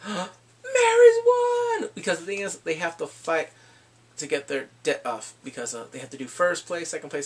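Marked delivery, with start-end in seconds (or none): 0.1-1.9 loud, high, drawn-out announcement; mock astonished cry; hammed-up amazed shout. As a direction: stretch it out in high-pitched shock. Retold gasp at the win.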